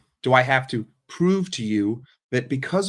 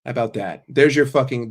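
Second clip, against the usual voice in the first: ordinary talk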